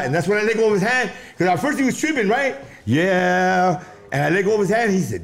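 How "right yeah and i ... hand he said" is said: normal talking voice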